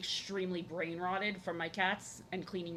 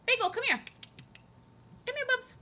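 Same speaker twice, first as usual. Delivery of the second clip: much higher, louder sing-song call; calling the cat; 5 dB louder than usual. Real, not acted. Streamer calling her cat over, high and loud.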